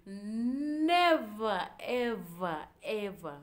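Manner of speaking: NEVER loud, high and stretched out; emphatic vow. Real, not acted. Vowing nobody disrespects her parents, Never loudly drawn out. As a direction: punch Never, then each ever as its own beat.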